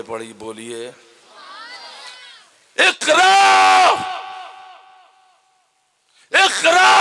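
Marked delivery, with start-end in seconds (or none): 2.8-6.9 high, loud angelic command; commanding shout. Acted. Angel Jibril commanding the Prophet to read, shouted. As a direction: call it out like thunder.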